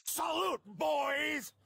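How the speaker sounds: shouted order. Real, not acted. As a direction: yell the last words at them. Arrested man barking orders at cops.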